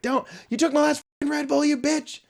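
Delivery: high-pitched retold rant; retold angry rant. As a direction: snap at him, mock angry. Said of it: "I bitched at him".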